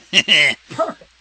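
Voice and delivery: ordinary delivery, nothing marked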